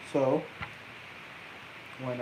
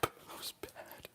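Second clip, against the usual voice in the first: whispered; 14 dB quieter, much slower than usual. Real, not acted. Reacts under his breath.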